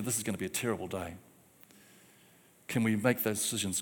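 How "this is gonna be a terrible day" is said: low, very soft, quick mumbled gloom; depressive thought. Acted. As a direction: voice a gloomy morning thought under your breath. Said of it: "go straight to a depressive thought".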